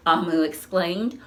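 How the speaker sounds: usual speaking voice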